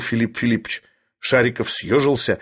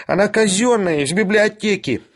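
high, desperate protest; dismayed squealing character voice; despairing; 9 semitones higher than usual. A narrator's reading. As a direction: plead in a pitched-up whine. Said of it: "отчаянно воскликнул Шариков".

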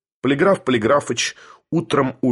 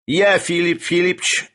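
lightly voiced upstart character, higher pitch; Sharikov; 6 semitones higher than usual. A narrator's reading. Sharikov addresses Filipp Filippovich, lightly voiced upstart. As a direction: raise the voice into a character part.

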